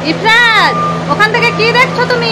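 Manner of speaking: high, sing-song calling. Real, not acted. Calling child from distance.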